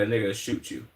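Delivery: usual speaking voice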